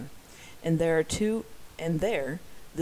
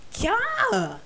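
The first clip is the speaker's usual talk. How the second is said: high scream; 6 dB louder than usual. Acted. Woman screams at knife attack.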